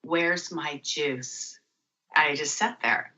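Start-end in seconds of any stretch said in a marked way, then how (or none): 0.0-1.6 drawn out and hushed; slow, soft wondering. Retold or imitated. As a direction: ask yourself slowly, quietly. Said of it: "That's exactly what came out of my mouth".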